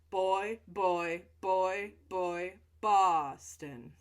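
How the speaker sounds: put-on loud, punchy Boy and Boston with popped B's; loud jaw-relaxing chant of Boy and Boston; loud repeated phrase. Shown as a demonstration. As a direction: pop each B hard to loosen the jaw. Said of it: "Let's do one more".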